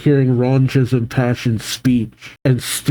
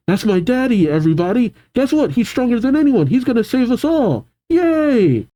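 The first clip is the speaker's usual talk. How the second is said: put-on high-pitched bragging child; high, thrilled kid voice; excited little boy; 10 semitones higher than usual. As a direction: squeal like a proud small kid. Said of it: "as Cullen says".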